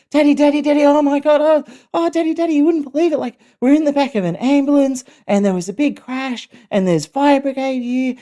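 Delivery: high, loud, breathless-with-news child impression; excited kids. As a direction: imitate thrilled children bursting to tell Dad. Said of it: "they were really excited to tell me".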